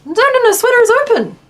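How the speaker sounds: high, loud no no no catching her own mistake; a jolt of realising she said it wrong; sudden self-correction. Real, not acted. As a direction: blurt it out, startled at herself. Correcting herself, outburst.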